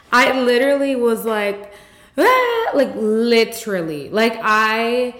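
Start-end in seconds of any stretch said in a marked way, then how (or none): 2.2-2.7 high, loud mock crying "ah"; mock wail. Patient retelling how she cried like a baby, mock wail. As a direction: burst out in an exaggerated baby-like bawl. Re-enacting her crying at appointment.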